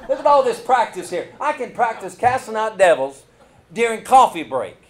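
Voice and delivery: joyful pulpit exclamation; excited preaching. Real, not acted. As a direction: lift the crowd with it.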